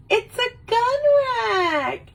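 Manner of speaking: very high, thrilled squeal; high-pitched mock excitement; stoked ex-girlfriend. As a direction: squeal it with over-the-top delight. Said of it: "She was, like, so stoked about it".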